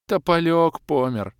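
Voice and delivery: slow, high mournful elf voice; sighing. Acted. Elf mourning his dead ghost, slow sigh. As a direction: sigh out the loss. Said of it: "вздохнул лысый эльф".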